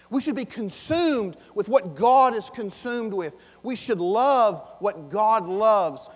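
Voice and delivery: loud, high, fervent preaching; preaching at full force. Acted. Pastor to congregation, loud fervent preaching. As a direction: proclaim it with rising fire.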